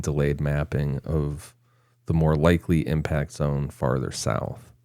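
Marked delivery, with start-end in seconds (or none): none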